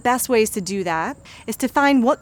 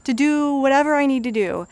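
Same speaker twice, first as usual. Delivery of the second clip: higher, louder forced cheer; over-the-top positive voice; 6 semitones higher, 5 dB louder than usual. Shown as a demonstration. Coach mocking a forced affirmation, higher louder voice. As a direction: overdo the affirmation until it sounds fake. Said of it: "instead of going".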